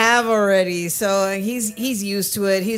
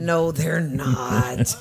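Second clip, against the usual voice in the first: very slow, lower-pitched contradiction; drawn-out denial; 4 semitones lower, much slower than usual. Real, not acted. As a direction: stretch out each word to shut down the tease. Drawn-out denial to a teasing interjection.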